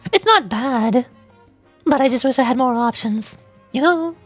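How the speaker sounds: put-on mommy voice, modestly done; mommy character voice. Shown as a demonstration. Streamer showing the best mommy voice they can get, role voice. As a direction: attempt a dommy mommy character. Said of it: "Something like this is probably the best".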